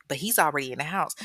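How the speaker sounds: ordinary talk, nothing marked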